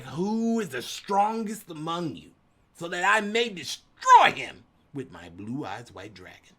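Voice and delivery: pompous anime villain challenge, booming at the end; grand challenger. Retold or imitated. Storming into the card shop, hammy challenge. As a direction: declare it like a duelist king entering the arena. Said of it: "was like".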